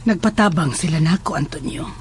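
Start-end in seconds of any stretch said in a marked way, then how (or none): 1.3-1.9 breathy whisper of his name. Acted. Mother quietly answering her son, whispering. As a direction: let the name fall to a whisper.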